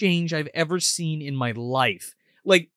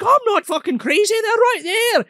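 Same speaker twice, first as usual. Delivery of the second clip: high, loud mock outburst; hammed-up indignant insistence; indignant protest; 13 semitones higher, 4 dB louder than usual. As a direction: protest like an exasperated old man. Acting grandpa insisting he's sane.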